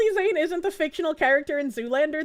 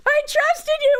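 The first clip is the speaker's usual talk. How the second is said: mock betrayed wail; 10 semitones higher, 5 dB louder than usual. Retelling the friend's prank as a mock betrayal. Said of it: "It's like".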